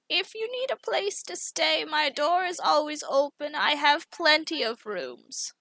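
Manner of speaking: put-on a kindly old lady calling after a departing guest; Grandma Joan. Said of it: "She's like".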